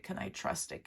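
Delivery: breathy, hushed self-question; whispered doubt. Acted. Voicing the client's secret doubt in a whisper. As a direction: whisper it to yourself, unsure.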